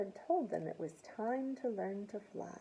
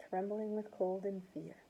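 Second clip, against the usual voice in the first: usual speaking voice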